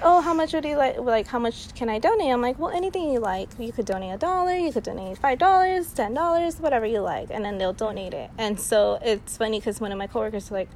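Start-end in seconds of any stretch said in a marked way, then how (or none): 0.0-2.3 higher, louder eager question; lightly acted shopper's reply; imitated customer. Acting out customer replying to pitch. 2.6-7.3 high, lilting and obliging; demonstrating a sugary, higher-pitched sales patter; sweet sales voice. Shown as a demonstration. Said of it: "It's usually, like, sweet".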